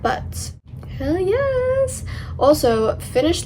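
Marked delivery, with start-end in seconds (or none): 1.0-2.0 excited. Real, not acted. Celebrating finishing the pillow side.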